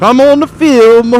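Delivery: high, loud, drawn-out mock Italian accent. Rider voicing the man on the Italian bike, high mock Italian accent. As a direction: crow it in a silly Italian voice. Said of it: "Why am I doing an Italian voice?".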